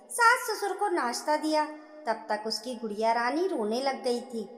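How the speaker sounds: ordinary delivery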